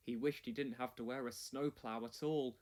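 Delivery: plain reading voice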